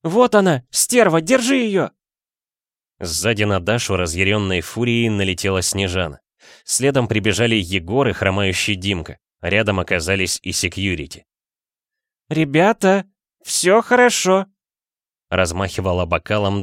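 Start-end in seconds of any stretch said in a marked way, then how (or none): 0.0-1.9 high, loud shrieking cry; battle cry. A narrator's reading. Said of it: "боевой клич". 12.3-14.5 put-on drunk woman. A narrator's reading. Drunk Dasha waving glass.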